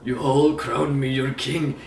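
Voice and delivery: slow, hammed-up Scottish accent. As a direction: proclaim it like a Scottish king, slowly. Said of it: "It's just Scottish".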